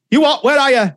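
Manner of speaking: high, loud mock disbelief; acted outburst down the phone; alarmed husband. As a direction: demand it as the shocked husband. Husband hears the car is crashed.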